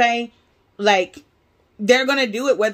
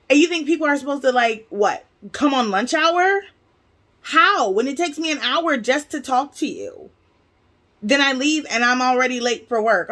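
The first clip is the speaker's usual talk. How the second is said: boiling retold outburst; 5 semitones higher than usual. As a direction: fire the questions at the clerk, indignant. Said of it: "I'm boiling".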